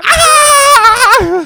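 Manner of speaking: high piercing mock shriek; hyena shriek. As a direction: let out a wild hyena screech. Mimicking hyena laugh shriek.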